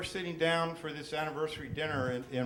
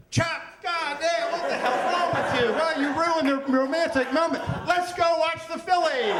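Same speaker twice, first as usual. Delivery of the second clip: mock group yell; 12 semitones higher, 8 dB louder than usual. Everyone yells at Michael together.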